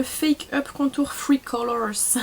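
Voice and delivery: put-on slow, playful English accent; hammed-up English accent from a French speaker. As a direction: draw the product name out in a bad English accent. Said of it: "J'aime trop faire l'accent anglais".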